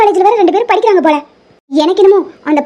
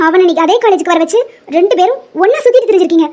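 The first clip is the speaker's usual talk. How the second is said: ordinary talk, nothing marked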